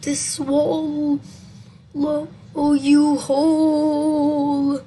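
loud, slow, stretched ghostly threat; spooky creature. Acted. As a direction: hold the vowels in a long wail. Creature answers in the story.

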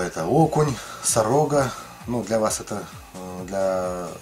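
ordinary talk, nothing marked

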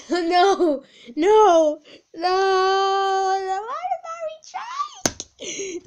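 high, drawn-out wail of refusal; over-the-top despairing cry; dramatic wailing. Real, not acted. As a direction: wail it like a hammed-up tantrum.

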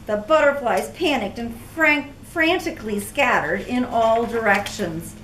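ordinary delivery, nothing marked

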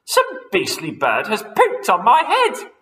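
high, loud indignant complaint; outraged wife; disgusted outrage. Acted. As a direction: Missus Twit complaining, loud. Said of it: "cried Missus Twit".

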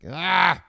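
abrupt loud "ah!"; sudden yell. Real, not acted. Cuts off own sentence.

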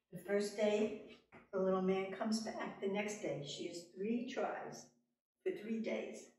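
ordinary delivery, nothing marked

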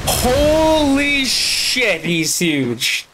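a long, high, drawn-out gasp of awe; gaping up at the giant Lord Jabu Jabu, stunned and slow. Real, not acted. Player sees the huge boss, drawn-out awestruck exclaim.

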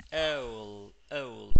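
"owl" slowly, as a long falling glide; drawn-out diphthong. Shown as a demonstration. Teacher models for a student, "owl" with drawn-out diphthong. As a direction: stretch the glide in "owl" before the L. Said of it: "la catorce tiene un sonido de".

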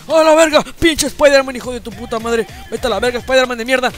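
loud angry cursing at an enemy player; furious yell. Acted. Old grandpa cursing Spider-Man who attacked him, in a furious yell. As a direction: scream insults at Spider-Man.

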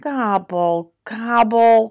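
lightly voiced grumpy turkey; grumbling gobble. A narrator's reading. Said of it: "grumbled Turkey".

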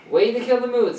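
loud. Acted. Annoyed line to Scooter.

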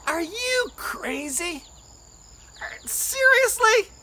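slow, high, drawn-out disbelief; high-pitched astonishment; incredulous scoff. Acted. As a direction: question someone's sanity, stretching each word. Others scoff at singing idea.

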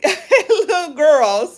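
laughing through the words, slowly. Real, not acted. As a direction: chuckle out the words fondly. Laughing at the fans' arrival.

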